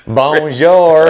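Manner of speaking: put-on teasing the wife's French with a Kentucky accent. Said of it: "with her strange Kentucky accent".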